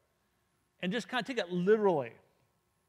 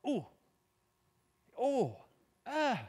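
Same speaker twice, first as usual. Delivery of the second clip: higher mock grunts of surprise, ooh, oh, ah; impressed grunts; 8 semitones higher, much slower than usual. Joe reacting to the Sermon being read, imitated grunts. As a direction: let out small amazed grunts, rising. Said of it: "he would actually grunt".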